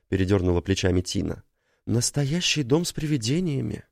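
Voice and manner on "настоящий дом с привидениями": higher, thrilled exclamation; excited. Acted. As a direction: gasp it out, delighted. Tina exclaims at spooky house.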